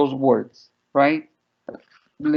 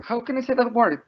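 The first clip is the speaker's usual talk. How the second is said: put-on puzzled child; 8 semitones higher than usual. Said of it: "she was like".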